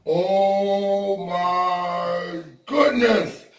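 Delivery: very slow, drawn-out gasp of shock. Real, not acted. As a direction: stretch it out in disbelief. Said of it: "I was in shock".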